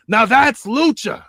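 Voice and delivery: high, loud, slow exclamation; excited call. Acted. Commentator hyping the tornado move, slow loud high exclamation.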